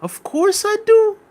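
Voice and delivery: slow Scouse impression leaping very high at the end; put-on Scouse accent. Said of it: "he says".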